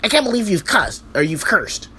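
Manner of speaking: high, shocked schoolteacher voice, well above his own; mocking the fussy teacher in a squeaky high voice; mocking prissy teacher. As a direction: mimic an uptight teacher clutching pearls. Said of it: "he's like".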